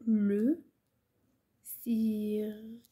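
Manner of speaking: very slow, pause after لو; word-by-word dictation. Shown as a demonstration. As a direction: say لو, wait, then draw سيرك right out. Repeats word by word for writing. (إملاء بطيء جدا كلمة بكلمة)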